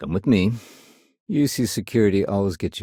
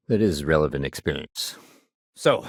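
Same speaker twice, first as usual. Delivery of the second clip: usual speaking voice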